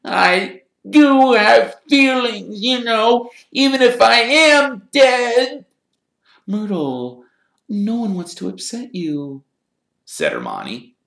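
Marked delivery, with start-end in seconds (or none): 0.1-5.7 very high, loud tearful wail; choked with tears. A narrator's reading. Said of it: "in a voice choked with tears". 6.5-9.4 high, gentle reassurance; calm a tearful ghost softly; soothing. Acted.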